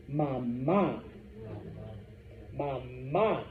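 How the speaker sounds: "mama" as ma-MA, second syllable long; stress on final A. Shown as a demonstration. Teacher has class repeat word for mom, "mama" stressed ma-MA. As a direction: lean on the last A of "mama". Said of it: "extra stress on the A".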